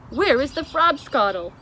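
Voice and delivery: very loud disgusted cry; BFG disgusted cry. A narrator's reading. The giant tells the Queen the coffee is vile, loud. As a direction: spit the word out in disgust. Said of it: "the B F G cried out".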